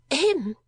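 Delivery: a dainty, high little ahem; delicate cough. A narrator's reading. Miss La Creevy announces herself with a dainty high cough. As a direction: clear the throat daintily and primly.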